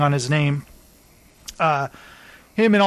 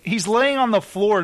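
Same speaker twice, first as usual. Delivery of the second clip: ordinary delivery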